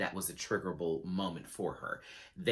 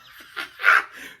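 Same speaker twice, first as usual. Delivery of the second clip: loud cackle; 9 dB louder than usual. Real, not acted. Cackle after impression.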